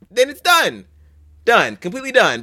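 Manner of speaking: done thrown up high, insisting; emphatic done. Real, not acted. Ranting about the fix.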